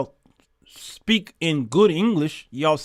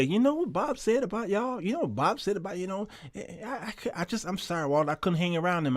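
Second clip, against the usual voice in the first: retold friend's tip-off, much softer; hushed confiding; 3 semitones higher, 7 dB quieter than usual. Friends confide Bob's remarks.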